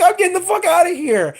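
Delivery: high, hammed-up fleeing kid; mock panicked kid. Little boy fleeing the house, hammed-up panic. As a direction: bolt out of there in a fake panic. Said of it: "And he's like".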